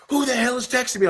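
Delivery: very high-pitched indignant demand; high mock-outraged cry; all fired up. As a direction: re-enact exasperation at a second text. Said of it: "I was all fired up".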